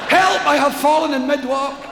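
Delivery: high panicked shout for help; calling for help. Acted. Falling man shouting up the cliff in panic. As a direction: yell out as you fall.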